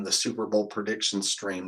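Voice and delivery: usual speaking voice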